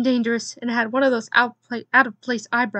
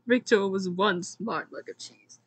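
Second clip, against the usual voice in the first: usual speaking voice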